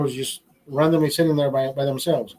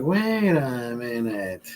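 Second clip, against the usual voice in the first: slow realization; 4 semitones lower, 4 dB quieter, much slower than usual. Retold or imitated. Realizing the trick works.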